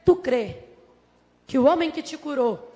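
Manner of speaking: low, measured question with pauses; voice of Jesus. Acted. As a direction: put the question solemnly as Jesus.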